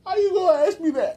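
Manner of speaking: very high, loud squeal; mock outrage. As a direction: squeal it in hammed-up indignation.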